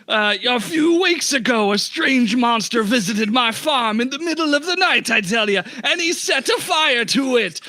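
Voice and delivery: hammed-up Jamaican storytelling; Jamaican accent. Shopkeeper telling the party about the monster, Jamaican accent. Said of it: "Jamaican accent".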